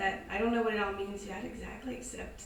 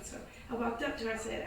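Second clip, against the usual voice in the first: normal talking voice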